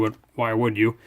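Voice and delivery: ordinary delivery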